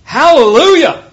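high, loud exultant shout; full-voiced shout of praise; crying out. A narrator's reading. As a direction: cry it out like a great multitude. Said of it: "the loud voice of a great multitude in heaven crying out".

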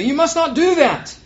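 emphatic, high-pitched forbidding; urgent rebuke. A narrator's reading. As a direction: stop the listener with a loud correction. Angel forbids John's worship, acted.

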